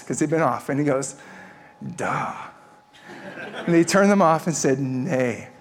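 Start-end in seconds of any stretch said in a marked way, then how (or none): 2.0-2.3 imitating Bosnian officer. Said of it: "I'm gonna speak Bosnian". 4.8-5.5 imitating Bosnian officer. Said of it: "I'm gonna speak Bosnian".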